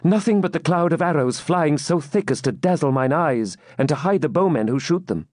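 a touch of alarm; lightly voiced anxious maiden. Maiden at lattice reports the arrows, tense.

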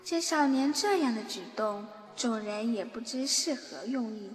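normal talking voice

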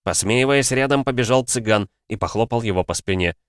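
usual speaking voice

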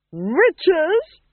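high, loud rising cry; shrill alarm. A narrator's reading. Duchess reacting to news of witches, loud high cry. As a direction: screech the word in outrage.

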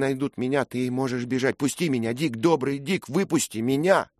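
a girl's urgent plea, lightly voiced; lightly voiced desperate girl; pleading girl.

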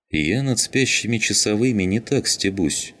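plain reading voice

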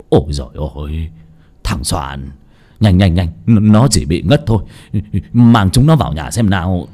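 put-on low, stuttering old folk in shock; alarmed stammer. A narrator's reading. Old couple finding their nephew fainted, flustered stammer. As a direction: stumble over repeated words, keeping it low. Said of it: "cả hai ông già bà già lắp bắp".

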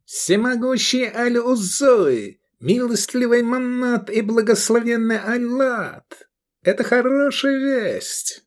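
high, sustained invocation; exultant cry. A narrator's reading. Sheriff praises his goddesses for the news, crying out. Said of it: "вскричал Рахаль".

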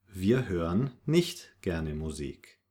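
ordinary talk

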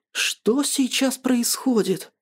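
high, slow, baffled question; stunned bewilderment. A narrator's reading. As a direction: draw it out in disbelief. Stunned friend watches the scene.

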